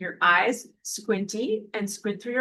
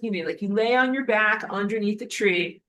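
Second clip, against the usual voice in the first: ordinary delivery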